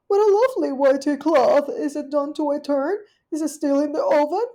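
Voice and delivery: high, loud genteel caller; a polite little dog at the door; Duchess's voice. Acted. Duchess calling at Rivy's porch, high and genteel.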